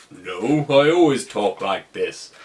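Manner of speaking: slow, forced low voice; Peter Quill's deepened voice. Retold or imitated. Star-Lord denying he copies Thor, voice slow and deep. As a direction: deny it in an obviously put-on deep voice. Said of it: "are you putting on a deeper voice to match his".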